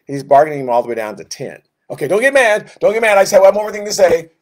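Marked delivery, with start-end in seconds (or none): 1.9-4.3 louder, much higher anxious begging; frantic pleading. Acted. Abraham begging an angry God, loud and frantic. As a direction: plead loudly, hands up, please don't get mad.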